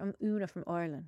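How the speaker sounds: low, soft Irish accent; Irish accent impression. Shown as a demonstration. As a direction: introduce yourself in a put-on Irish accent. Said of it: "That was fucking brilliant".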